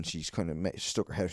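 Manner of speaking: ordinary talk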